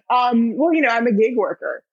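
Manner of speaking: usual speaking voice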